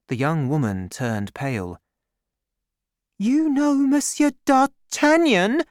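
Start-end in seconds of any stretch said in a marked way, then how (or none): 3.2-5.6 high, loud jealous outburst; jealous cry. A narrator's reading. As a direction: blurt it out, stung with jealousy. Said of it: "she cried jealously".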